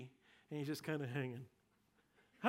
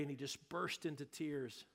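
normal talking voice

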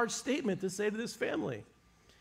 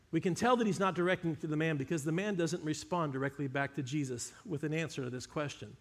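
normal talking voice

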